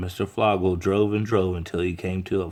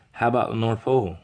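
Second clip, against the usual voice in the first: brisk postal clerk; 4 semitones higher, 3 dB louder, faster than usual. Acted.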